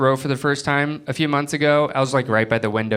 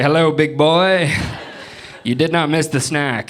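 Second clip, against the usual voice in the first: a hint of a sultry line; mock flirty; 3 semitones higher than usual. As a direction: play the seducer, half-joking. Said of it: "was like".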